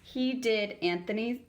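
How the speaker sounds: plain reading voice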